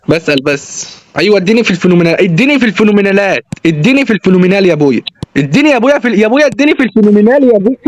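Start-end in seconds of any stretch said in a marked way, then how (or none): 5.4-7.8 high, rapid-fire begging; heated, high-pitched demand; worked-up pleading. Real, not acted. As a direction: plead insistently, pitched up and rushed. Begging heatedly for the phenomenal pass.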